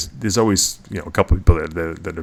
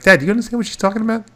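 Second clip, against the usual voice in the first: incredulous; 13 semitones higher, faster than usual. Retold or imitated. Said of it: "I'm like".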